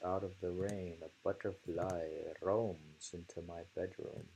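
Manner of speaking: normal talking voice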